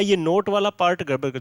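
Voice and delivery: ordinary talk, nothing marked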